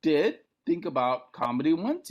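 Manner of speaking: put-on a touch of a bashful young guy; sheepish self. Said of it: "I'm like".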